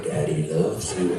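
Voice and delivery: very low, slow mock reassurance; imitated uniformed guard. Uniformed dude to man on the toilet, deep slow comfort.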